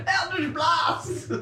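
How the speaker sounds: high, drawn-out spell shout; high-pitched holler, stretched long; battle cry. Acted. Gleefully calls out spell name.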